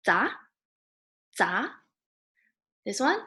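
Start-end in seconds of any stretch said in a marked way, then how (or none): 0.1-1.7 "za" sweeping upward in pitch; second tone. Shown as a demonstration. Teacher modelling the syllable for learners, rising second tone. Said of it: "So this one is second tone".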